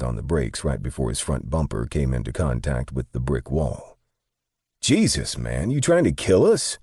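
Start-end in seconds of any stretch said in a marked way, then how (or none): 4.8-6.8 high-pitched, alarmed protest; indignant huff. A narrator's reading. Passenger to partner after slammed brakes, huffing high in protest. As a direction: huff it at a reckless driver, voice jumping up. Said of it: "Day huffed".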